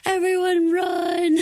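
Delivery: high, slow, loud warning call; alarmed evacuation cry. Retold or imitated. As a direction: urge everyone out in panic. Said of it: "It's like".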